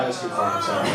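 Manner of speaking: normal talking voice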